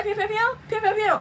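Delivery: repeated high imitated gunshot noises; Instagram girl's pews. Imitating the Instagram girl at the party, high pew noises. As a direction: fire off playful pews in a high voice. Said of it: "that do the pew".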